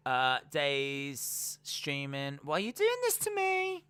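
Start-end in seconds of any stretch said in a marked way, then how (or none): 2.4-3.8 very high squealed plea; Mandy Rose impression. Host mimicking a floored wrestler, squealed mock plea. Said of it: "just Mandy Rose on the floor going".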